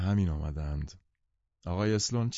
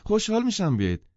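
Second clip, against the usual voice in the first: very high, louder woman's voice; eager, pitched-up persuasion; insisting; 12 semitones higher, 5 dB louder than usual. A narrator's reading. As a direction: press the invitation as truly meant. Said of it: "زن باز اصرار کرد".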